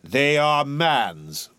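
slow, grave, otherworldly pronouncement; solemn spirit voice. A narrator's reading. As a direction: lay down a heavy truth. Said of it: "said the spirit".

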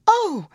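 sharp high despairing oh; a shrill gasp of dismay; anguished cry. A narrator's reading. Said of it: "despair in its anguished cries".

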